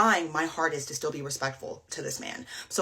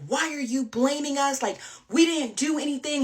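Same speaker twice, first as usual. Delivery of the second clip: high-pitched imitation of yelled protest; mock yelling; 7 semitones higher than usual. Hinge guy screaming back at her, mock yell. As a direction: imitate a defensive yell, raised in pitch but not volume. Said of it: "He's screaming back at me".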